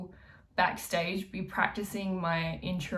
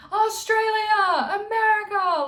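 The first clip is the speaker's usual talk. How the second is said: much higher, much louder drawn-out yell; 8 dB louder than usual. Shown as a demonstration. Warming up the voice backstage, high drawn-out yell. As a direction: belt the country name backstage. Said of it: "please just go and yell".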